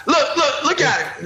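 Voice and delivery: ordinary talk, nothing marked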